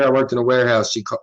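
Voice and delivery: ordinary talk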